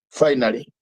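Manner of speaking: normal talking voice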